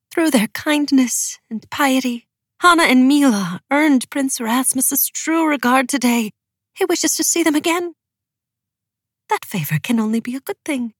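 high, lilting woman's voice; hopeful wife. A narrator's reading. Wife telling of the prince's regard, high and hopeful. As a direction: pitch it well up, full of hope.

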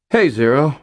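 put-on a dangling kid faking calm; lightly voiced nervous teen; forced casual. A narrator's reading. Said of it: "trying to sound casual".

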